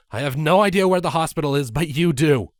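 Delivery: put-on rally the kids fast; urgent orders.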